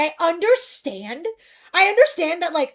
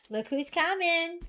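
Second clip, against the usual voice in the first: ordinary talk, nothing marked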